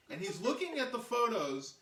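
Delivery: plain reading voice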